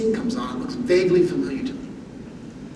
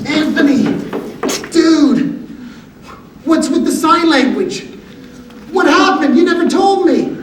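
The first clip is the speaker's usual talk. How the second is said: higher, louder, eager buddy voice; excited guy friend; 9 semitones higher, 6 dB louder than usual. Retold or imitated. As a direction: burst in like a curious pal. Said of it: "they'll come running up and they're like".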